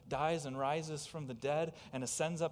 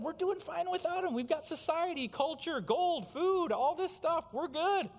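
much higher, bragging; boastful scoffer; 14 semitones higher, 6 dB louder than usual. Retold or imitated. Preacher voicing Noah's generation, high and boastful. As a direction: crow about having it all. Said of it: "what people in Noah's day said".

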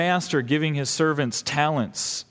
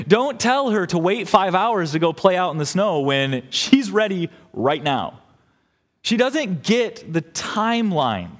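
ordinary talk, nothing marked

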